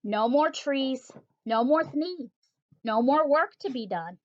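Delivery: ordinary delivery, nothing marked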